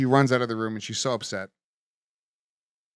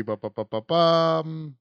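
normal talking voice

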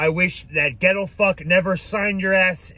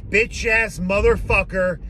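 ordinary talk

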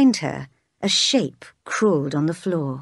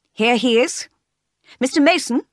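higher, louder call across the church; announcing the name loudly; 6 semitones higher, 4 dB louder than usual. A narrator's reading. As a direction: call the witness forward. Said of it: "called the lawyer".

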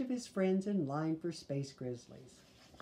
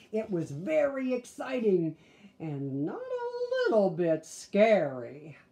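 excited; 3 semitones higher, 7 dB louder than usual. A narrator's reading. Reader dramatizes scary movie.

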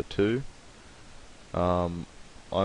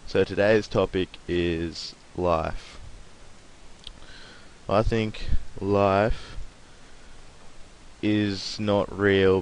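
mock profound; much slower than usual. Mock-serious philosophy intro.

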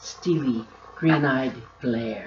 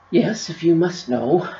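louder, higher, put-upon answer; exasperated sigh; 4 semitones higher, 8 dB louder than usual. A narrator's reading. Attic keeper answering Bookie's question, exasperated. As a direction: give in with sighing exasperation. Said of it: "answered with a sigh".

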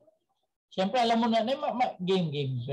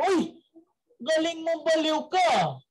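amazed doctor; 6 semitones higher than usual. Acted. Doctor praises madman.

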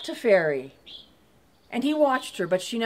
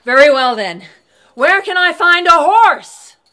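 loud demand; 5 semitones higher, 11 dB louder than usual. Acted. Thomas shouts to the voice.

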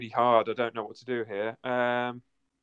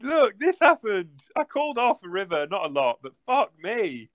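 exasperated; 7 semitones higher, 4 dB louder than usual. Real, not acted. Exasperated at loss.

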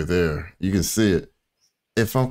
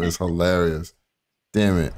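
normal talking voice

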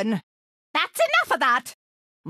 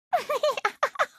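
high, light cackle; gleeful cackle; 11 semitones higher, 4 dB quieter than usual. Acted. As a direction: cackle with wicked glee. Game character gleeful at chaos.

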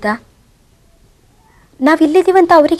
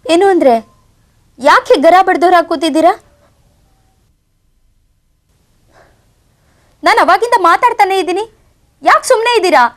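angry scolding; 6 semitones higher, 3 dB louder than usual. Acted. Wife scolds silent husband.

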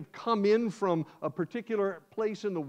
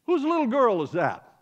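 calling out; 5 semitones higher, 6 dB louder than usual. Acted. Papa calls out in story.

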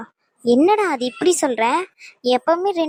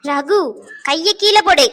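sharp command; 2 semitones higher, 4 dB louder, slower than usual. Acted. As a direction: shout him down before he strikes. Sister stops Raghu's raised hand.

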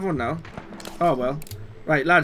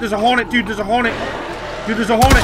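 alarmed; 6 semitones higher, 4 dB louder, faster than usual. Real, not acted. Alarmed by hornet enemy.

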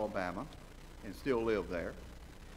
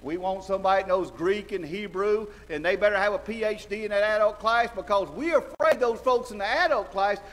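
loud, high mock indignation; sarcastic preaching; 9 semitones higher, 8 dB louder than usual. Preacher mocking adult-class priorities, loud sarcasm. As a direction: pile on the sarcasm.

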